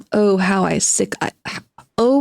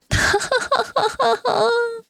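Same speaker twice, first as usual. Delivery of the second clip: high laugh. Real, not acted. Laughs at the passage.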